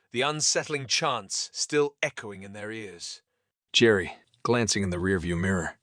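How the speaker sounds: ordinary delivery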